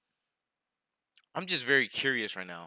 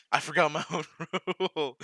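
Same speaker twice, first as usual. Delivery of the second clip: laughter breaking up the words; laughing admission; 5 semitones higher, 4 dB louder, faster than usual. Real, not acted. Streamer who forgot his own rule, laughing admission. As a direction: crack up before you can finish.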